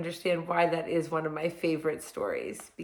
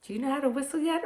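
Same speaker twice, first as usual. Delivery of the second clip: put-on high quick question to kids; bright fast host voice; kids show host; 8 semitones higher, much faster than usual. Teacher addresses kids brightly.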